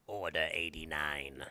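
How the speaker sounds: hushed, slow villain whisper of the command; sinister whisper. Shown as a demonstration. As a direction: breathe it out like a secret evil order. Whispered repeat of order.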